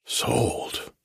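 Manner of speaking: breathless, unvoiced disbelief; a stunned, agonised whisper. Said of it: "stunned whisper".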